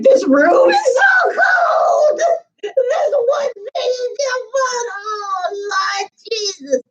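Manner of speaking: high, wailing sob-voice, words stretched out; hammed-up teary whine about everything; mock crying wail. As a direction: pretend cry-shout over small things. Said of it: "Everything is making me cry".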